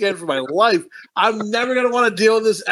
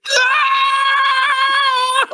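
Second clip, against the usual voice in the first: shrill pained mock scream, much louder; high scream; 4 dB louder than usual. Gang member stabbed in the hand, high scream. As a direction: scream like a knife went through your hand. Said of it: "the way he screams".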